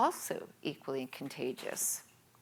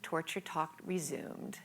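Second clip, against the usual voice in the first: whispered aside; 7 dB quieter than usual. A narrator's reading. Ominous aside in narration.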